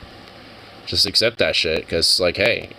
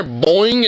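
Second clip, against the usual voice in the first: bunny boing; 9 semitones higher, 4 dB louder than usual. Retold or imitated. Bunny hopping into bin.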